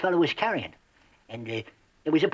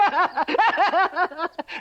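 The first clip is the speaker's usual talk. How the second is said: a shrill, loud burst of nervous laughter; high nervous cackle; 5 dB louder than usual. Acted. Trapper laughing nervously to the stranger, loud high cackle. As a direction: cackle loud and high to cover his nerves. Nervous chatter about French fella.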